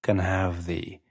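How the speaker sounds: slow hypnotic voice with long pauses between short phrases; hypnosis induction. Acted. Hypnotist guiding a listener to close their eyes, slow hypnotic delivery.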